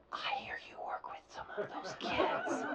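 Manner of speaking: hushed discreet whisper. Retold or imitated. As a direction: whisper it as a secret.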